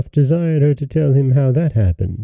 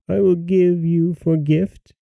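higher, measured fairy voice; 7 semitones higher than usual. A narrator's reading. Fairy bestowing a gift on the girl, higher voice. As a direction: speak as a fairy granting a gift. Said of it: "continued the fairy".

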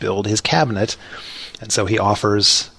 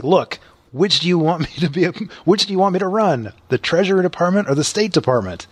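eager voice, pitched well up; pushy, impatient young man; bad acting; 7 semitones higher, faster than usual. Hamilton pressing Washington for a job.

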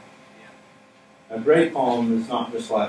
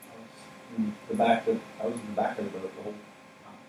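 soft, hushed gentle reminder; softly reassuring disciples he was there; gentle quiet; 2 semitones lower, 12 dB quieter than usual. Acted. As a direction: lean in and say it softly. Jesus gently reminding them.

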